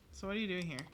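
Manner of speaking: ordinary delivery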